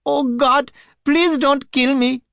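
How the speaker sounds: high, loud, sobbing begging; sobbing plea. A narrator's reading. Terrified man on the floor sobs for mercy. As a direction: beg for your life through sobs. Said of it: "the sobbing man".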